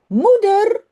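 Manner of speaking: put-on child calling his mother. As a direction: call to mother from the other room. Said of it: "roept Jip".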